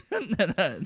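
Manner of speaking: warm motherly laugh; mom laugh. Real, not acted. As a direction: chuckle like a mom. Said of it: "Doing the mom laugh".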